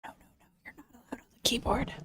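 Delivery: hushed scolding whisper. Real, not acted. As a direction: whisper it sharply at the pet. Scolding the pet at the keyboard.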